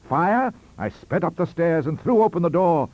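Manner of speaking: alarmed narrator racing upstairs; sudden alarm. A narrator's reading. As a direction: read it like you've just spotted fire. Narrator discovers strange light, alarm.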